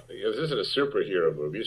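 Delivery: ordinary delivery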